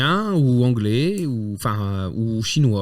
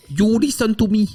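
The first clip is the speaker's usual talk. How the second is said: high, put-on Chinese accent in English; bark-free mock Chinese English, pitched up; 6 semitones higher than usual. Game master as police chief, Chinese-accented English. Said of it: "un accent chinois en anglais".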